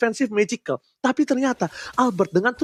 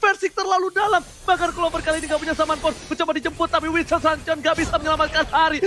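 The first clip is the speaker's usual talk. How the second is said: rising hype play-by-play; fighting game announcer; 6 semitones higher than usual. Acted. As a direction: call it like a big fight. Hyped esports casting.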